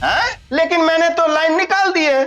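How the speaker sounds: high, taunting gloat; mock her helplessness; gloating villain. Villain gloats after cutting line.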